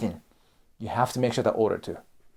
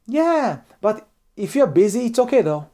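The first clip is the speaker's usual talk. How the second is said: much higher, easygoing reassurance; gentle backing off; 8 semitones higher, 3 dB louder than usual. Shown as a demonstration. Speaker reassuring a hesitant colleague, gentle. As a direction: let them off the hook kindly. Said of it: "the right answer is this".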